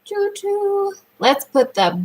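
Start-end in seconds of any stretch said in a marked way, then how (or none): 0.0-0.9 high sing-song choo-choo; train whistle. Pretend. Teacher makes a train noise for a child, playful high toot.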